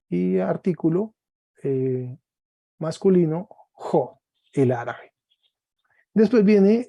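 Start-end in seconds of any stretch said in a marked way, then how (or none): none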